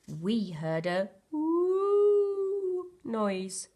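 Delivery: high, very slow little bear; little bear voice, drawn-out woo. A narrator's reading. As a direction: stretch out the woo. Bear imitates ghost noise.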